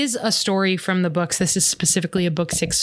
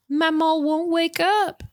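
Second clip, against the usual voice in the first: put-on high little-kid voice slipping into a Southern accent; slow, high Southern drawl for a small child; Southern-tinged child's plea, pitched high; accidental Southern accent; 9 semitones higher, slower than usual. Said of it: "I don't know why I went southern there".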